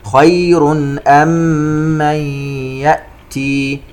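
slow chant, "من" hummed into the y of "يأتي"; idgham with ghunna. Shown as a demonstration. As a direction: clear n in "خير" before "أم", then hum "من يأتي". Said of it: "إدغام بغنة". (ترتيل بإدغام "من يأتي" بغنة)

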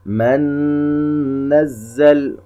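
من نزل said as man-nazzal, N hummed long; doubled nun with ghunna. Shown as a demonstration. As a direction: hold the nasal N joining من to نزل. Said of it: "حرف النون مشدد". (نون مشددة مغنونة في من نزل)

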